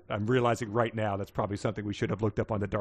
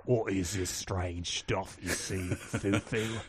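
slow, lower mock-British voice; British accent; 5 semitones lower, 6 dB quieter, much slower than usual. Teen questions the strange food, British accent. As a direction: draw it out in hammy British. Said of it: "he did it in a British accent".

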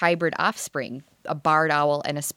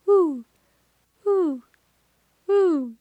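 high hollow hoots; playful high owl hoots; spotted owl call; 11 semitones higher, much slower than usual. Retold or imitated. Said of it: "the spotted owl sounds like".

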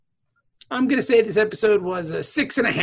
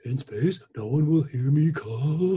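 horror trailer voice; 4 semitones lower, 4 dB quieter, slower than usual. Pretend. Trailer tagline parody, drawn out.

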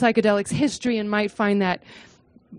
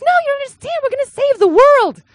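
much higher, fervent and pleading; eager protest; 13 semitones higher, 7 dB louder than usual. Retold or imitated. Student pleading with her doubtful advisor, higher and fervent. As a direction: insist wide-eyed on saving the world.

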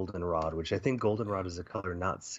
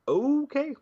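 very high, loud squeaked okay; high-pitched dismayed acceptance, hammed up; mock resigned; 5 dB louder than usual. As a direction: give up in a comically high pitch. Acts out the Brigadier giving up.

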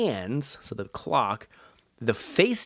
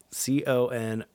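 ordinary delivery

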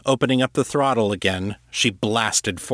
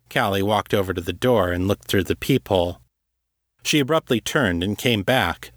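ordinary delivery

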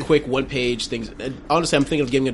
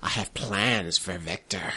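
low, slow scheming crone; a hint of menace as the witch; old hag; 6 semitones lower, 6 dB quieter, slower than usual. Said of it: "the witch".

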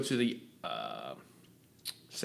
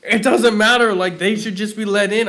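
louder, higher lightly voiced important man; imitating the director; 9 semitones higher, 13 dB louder than usual. Director brushing off the badge rule, loud mock voice. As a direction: toss it off like a big shot.

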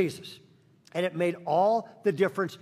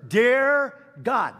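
put-on high, loud little boy prayer voice; child praying; 5 semitones higher, 6 dB louder than usual. Little boy praying loudly at dinner. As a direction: pray out loud like an eager kid. Boy prays aloud in restaurant.

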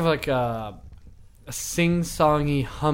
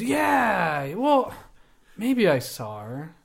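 NPC voice; 5 semitones higher than usual. Acted. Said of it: "he goes".

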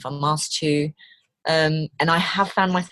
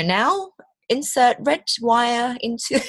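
slow, higher-pitched step-by-step prompt; instructional guide voice; 6 semitones higher, slower than usual. Shown as a demonstration. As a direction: talk an engineer through it, word by word. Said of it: "this voice talks you through it".

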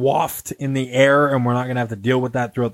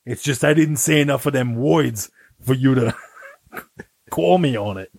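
put-on Brooklyn tech guy; comic Brooklyn accent, defending himself. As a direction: say it in hammed-up Brooklynese. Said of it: "kind of like a Brooklyn accent".

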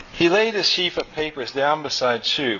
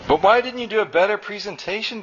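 demanding; 6 semitones higher, faster than usual. A narrator's reading. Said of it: "demanded Nectar".

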